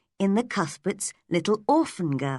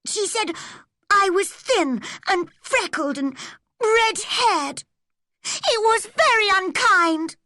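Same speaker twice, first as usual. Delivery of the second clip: put-on wounded girl; 4 dB louder than usual. A narrator's reading. Hurt child complains.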